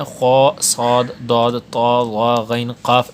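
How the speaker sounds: each heavy letter drawn out slowly, lips not rounded; heavy letters demo. Shown as a demonstration. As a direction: sound خا صاد داد طا وا غین قاف slowly and fully, lips flat. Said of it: "حروف مستعلیہ جو ہیں". (حروف مستعلیہ بغیر ہونٹ گول کیے، آہستہ)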